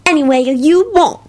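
high, drawn-out delighted coo; happy. A narrator's reading. Woman lets her partner choose, happy. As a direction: stretch it out high and happily. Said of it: "Solange told him happily".

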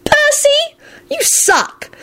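very high squealed insult. A narrator's reading. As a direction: squeal the jibe right up high. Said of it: "Ginny squealed".

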